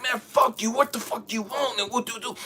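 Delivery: high-pitched, irritated brush-off; defiant reply. Retold or imitated. Elijah telling God he wants none of it, defiant.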